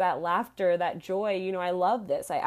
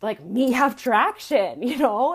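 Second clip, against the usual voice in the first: laughing; 4 semitones higher, 9 dB louder than usual. Real, not acted. Host laughing at her own caveman phrasing. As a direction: giggle the words out. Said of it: "I'm laughing because this is just so near".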